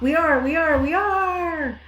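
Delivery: high bleating chorus; sheep chorus. Acted. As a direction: chant like a flock of sheep. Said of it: "chorused the flock".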